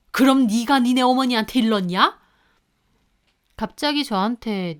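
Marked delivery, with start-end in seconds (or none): 0.1-2.2 angry accusation. Retold or imitated. Father accuses daughter-in-law.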